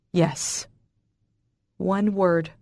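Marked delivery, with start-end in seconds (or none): none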